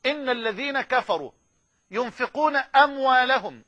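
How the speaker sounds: measured chanted recitation; تلاوة متأنية; recitation again. Shown as a demonstration. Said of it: "اسمع الآية تاني".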